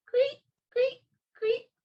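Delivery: very high, short chirps; cricket chirping. A narrator's reading. Reader imitating crickets for children, high chirping. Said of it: "The crickets go".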